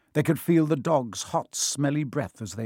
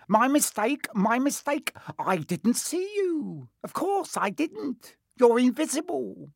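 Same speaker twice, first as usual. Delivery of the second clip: high-pitched fawning apology; greasy; 11 semitones higher than usual. A narrator's reading. Peeves begs the invisible Baron's forgiveness, high greasy fawning. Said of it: "he said greasily".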